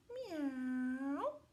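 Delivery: cat meow. Acted. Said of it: "said the cat".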